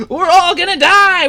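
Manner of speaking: high, loud panicked yell; panicked shout. Acted. Pingo shouting to everyone as the ship goes down. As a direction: shout it as pure terror. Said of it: "he starts to panic and he shouts".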